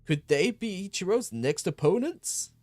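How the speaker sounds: loud, high ring announcer. Acted. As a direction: hype up the crowd. Announcer introduces the wrestlers.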